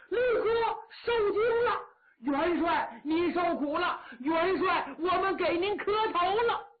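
high, loud calls of a crowd of kneeling generals; loud hailing greeting. Acted. Generals kneeling before Yang Liulang, greeting him in a loud hail. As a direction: call out to the marshal with fervour.

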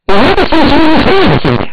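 sharp, high, loud astonished question; startled demand. Acted. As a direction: blurt it out in disbelief. Li Jing shocked by the shrine.

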